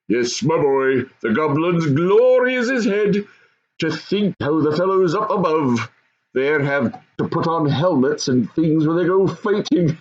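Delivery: lightly voiced goblin father.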